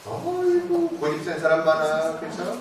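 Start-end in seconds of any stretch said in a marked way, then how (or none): 0.1-1.0 sharp high-pitched 어이구, a real sigh of exasperation; exasperated groan. Real, not acted. Lecturer to audience about stubborn people, high exasperated 어이구. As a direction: throw up your hands at stubborn people.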